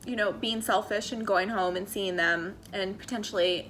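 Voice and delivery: ordinary talk, nothing marked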